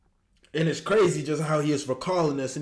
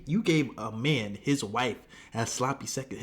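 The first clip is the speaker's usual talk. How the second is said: usual speaking voice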